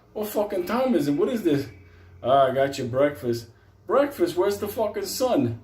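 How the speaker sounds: jolted awake and baffled; shocked and groggy. Retold or imitated.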